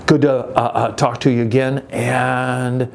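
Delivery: ordinary talk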